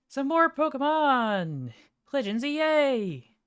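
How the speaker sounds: big drawn-out hype announcement; excited announcer. Real, not acted. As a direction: announce it like a game show host. Hyping the stream's game.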